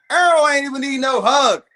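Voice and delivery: laughing through the jab; cracking up while mocking. Real, not acted. Laughing at the clip.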